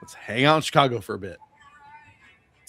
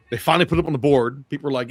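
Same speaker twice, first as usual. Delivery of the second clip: normal talking voice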